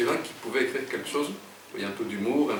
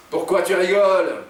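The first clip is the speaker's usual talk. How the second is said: raised, higher, louder demand; yelling; 7 semitones higher, 7 dB louder than usual. A narrator's reading. Jean confronts the laughing woman, yelling. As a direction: snap the question out loudly. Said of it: "hurle Jean".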